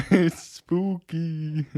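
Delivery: slow, high ghostly moan; unearthly voice. Pretend. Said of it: "You sound like a ghost".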